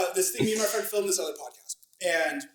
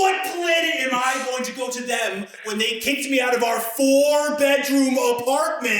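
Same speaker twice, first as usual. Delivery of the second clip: indignant yell; 6 semitones higher than usual. Retold or imitated. Retold outraged question to roommates.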